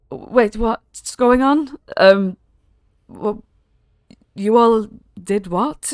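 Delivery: high, slow bewildered questions; confused disbelief. Acted. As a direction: drag out the confusion, baffled. Godmother confused on waking.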